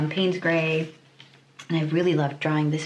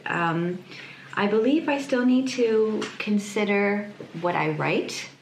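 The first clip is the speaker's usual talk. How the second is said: normal talking voice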